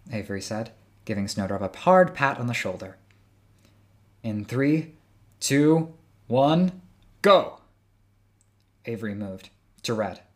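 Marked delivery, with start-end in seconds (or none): 4.2-7.6 rising countdown, ending in a shout; building countdown. Acted. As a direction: count down, louder each beat.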